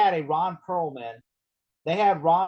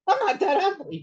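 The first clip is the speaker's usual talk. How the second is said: higher indignant protest; mock offended; 12 semitones higher than usual. Imitating Walton Goggins protesting, mildly indignant. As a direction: play hurt pride, lightly. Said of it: "Walt Goggins is like".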